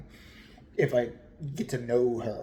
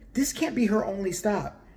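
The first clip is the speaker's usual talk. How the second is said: normal talking voice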